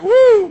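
very high, loud "woo"; hammed-up excited whoop; celebrating whoop. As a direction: whoop in mock self-congratulation. Acted self-celebrating [name].

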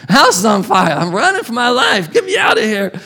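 high, hammed-up panicked cries; mock panic. Elijah running from Jezebel, hammed-up high panic. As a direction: play a scared man bolting.

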